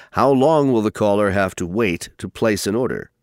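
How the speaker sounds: ordinary talk, nothing marked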